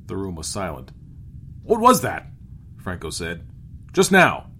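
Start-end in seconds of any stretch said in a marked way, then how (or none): 1.6-3.5 loud, high, startled demand; raised voice of disbelief; startled captain. A narrator's reading. Captain startled by talking animal. 3.9-4.5 loud, high, startled demand; raised voice of disbelief; startled captain. A narrator's reading. Captain startled by talking animal.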